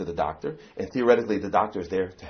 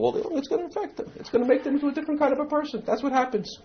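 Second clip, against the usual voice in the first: high pitched emphasis. Acted.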